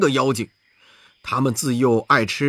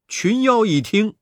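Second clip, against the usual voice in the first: usual speaking voice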